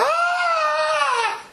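high mock wail of grief; mock wailing cry. Preacher imitates crying at a funeral, mock wail.

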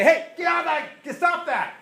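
high sharp yell at a dog digging. Retold or imitated. Owner yelling at the dog digging up the yard, a sharp high shout. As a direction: shout the dog away from the hole. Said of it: "You can say".

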